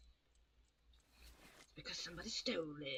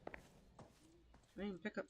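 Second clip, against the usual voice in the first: muttered; 10 dB quieter, slower than usual. Real, not acted. Muttering while failing in game.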